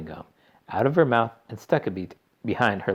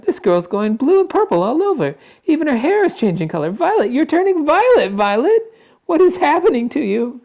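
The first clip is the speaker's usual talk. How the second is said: very high, loud, hysterical mother; panicked yell; 10 dB louder than usual. A narrator's reading. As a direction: wail in mounting horror. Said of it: "yelled Missus Beauregard".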